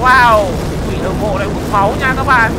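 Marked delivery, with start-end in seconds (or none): 0.0-0.4 loud, high burst of wow; amazed shout. Real, not acted. Reacting to monster draining blood. (hét to kinh ngạc)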